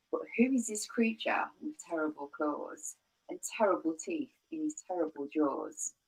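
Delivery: ordinary delivery, nothing marked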